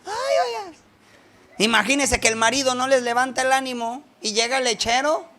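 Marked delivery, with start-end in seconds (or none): 0.0-0.8 much higher, coy flattered lady; high coy "ay, oiga"; flattered woman. Retold or imitated. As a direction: play the housewife blushing at a compliment. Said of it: "las señoras así".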